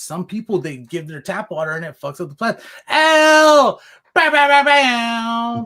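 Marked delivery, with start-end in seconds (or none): 2.9-5.6 loud, high hype call; hype shout. Acted. Hype exclamation for the AI.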